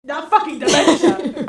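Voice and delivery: loud laughing denial. Real, not acted. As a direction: laugh while correcting. Laughing denial of wrong guess.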